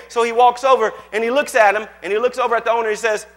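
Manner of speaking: ordinary talk, nothing marked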